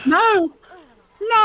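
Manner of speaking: high, loud anguished no; loud cry. Real, not acted. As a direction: shout in dismay. Player about to die in game.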